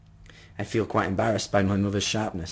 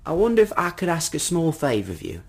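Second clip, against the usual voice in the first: lightly voiced old gentleman, higher pitched; polite old man; 6 semitones higher than usual. Old man asking a stranger for a favour, lightly voiced and polite. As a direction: ask courteously, as a hint of an old man.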